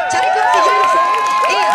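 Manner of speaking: a sudden high, loud shout at the officers; real shout. Real, not acted. Protester shouting at police to take off the uniform.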